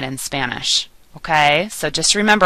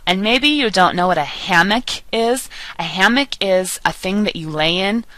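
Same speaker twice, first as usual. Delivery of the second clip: ordinary talk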